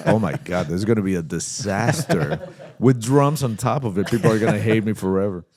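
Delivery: retold panic, speeding up at the end; paranoid panic. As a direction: wail about impending disaster, for laughs. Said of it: "So I got really paranoid".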